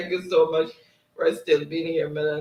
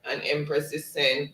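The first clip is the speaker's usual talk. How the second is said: usual speaking voice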